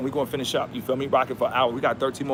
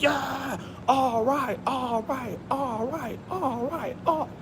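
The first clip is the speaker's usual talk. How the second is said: hyped shouting; 10 semitones higher, 5 dB quieter, much slower than usual. Retold or imitated. Hyped celebration at hitting fifty K.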